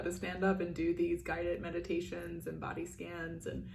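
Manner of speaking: normal talking voice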